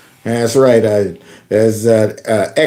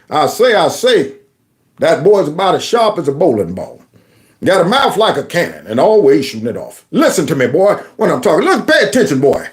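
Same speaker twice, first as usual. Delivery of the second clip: Foghorn Leghorn; 3 semitones higher than usual. Retold or imitated. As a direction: boom it out like a pompous Southern rooster. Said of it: "Gotta love Foghorn".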